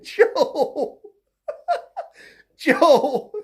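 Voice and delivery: exasperated name-call, cracking up; laughing. Real, not acted. Commentator calling to the candidate, laughing. Calls Joe while cracking up.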